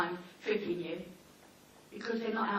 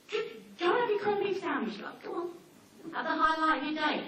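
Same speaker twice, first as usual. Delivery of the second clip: higher, sarcastic shooing; mock dismissive; 7 semitones higher, 3 dB louder than usual. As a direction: brush her off sweetly. Dismissing the neighbour.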